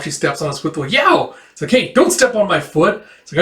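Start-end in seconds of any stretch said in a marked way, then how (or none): 0.9-1.4 high, quick cartoon yelp; mock pain yelp. Acting yelp at stepped-on foot. 1.7-3.0 raised-pitch playful scolding; mock indignant complaint. Acting complaint after stepped-on foot.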